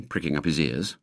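plain reading voice

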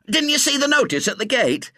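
high-pitched scolding demand; indignant. Acted. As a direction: challenge the trespasser in outrage. Outraged warden scolds the boy.

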